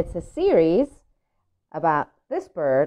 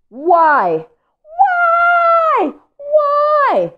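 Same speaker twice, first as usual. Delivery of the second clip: much higher, louder wailing whys; wailing pigeon; 10 dB louder, much slower than usual. Acted. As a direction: wail the question to the sky. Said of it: "here is Pigeon complaining".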